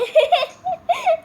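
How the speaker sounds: loud, high burst of laughter. Real, not acted. Laughing at her own bad play, high and loud. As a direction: laugh it off at herself.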